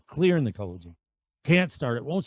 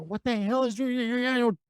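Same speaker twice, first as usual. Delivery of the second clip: frustrated retold outburst, pitched way up; cussing and screaming; 10 semitones higher than usual. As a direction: blow up at the dead bike. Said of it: "I start to cuss and scream".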